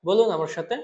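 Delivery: normal talking voice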